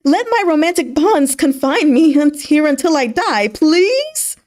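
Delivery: high, loud swooning please; mock pleading. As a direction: ham up a lovestruck plea. General swoons, reverses his boast.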